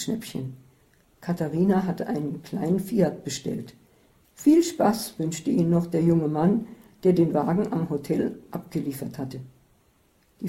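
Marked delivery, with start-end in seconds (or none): none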